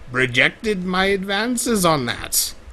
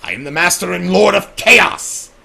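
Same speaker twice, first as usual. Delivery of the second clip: grandiose boast; 3 semitones higher, 7 dB louder than usual. Acted. Boasting villain self-proclamation.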